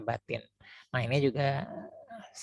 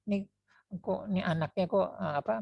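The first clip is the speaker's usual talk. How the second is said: mock annoyed; 6 semitones higher than usual. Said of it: "ada yang marah".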